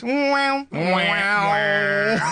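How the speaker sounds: high, drawn-out wah-wah; mock end-of-scene music sting; imitated sitcom music. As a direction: wail it like a comedy trombone. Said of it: "And then it goes".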